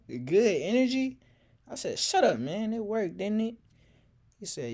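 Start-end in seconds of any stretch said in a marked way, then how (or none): 0.1-1.2 higher, louder incredulous echo with a rising end; mocking disbelief. Dre mocking the narrator's line, loud and incredulous. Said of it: "laughing so hard". 2.0-3.5 higher and louder, mock-indignant; defensive retort. Narrator brushing off his friend's teasing, mock-indignant.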